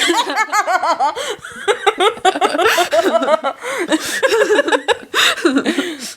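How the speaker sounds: can't stop laughing; high burst of laughter. Real, not acted. Laughing at own internet.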